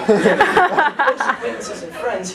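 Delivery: slow, laughing through the words; drawn-out laughing aside; laughing slowly. Real, not acted. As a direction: stretch it out while laughing. Laughing mock-scholarly.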